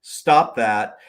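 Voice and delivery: high, slow scolding; imitating a judge telling him off; stern judge. Judge scolding the attorney, a stern mock rebuke. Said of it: "kept saying".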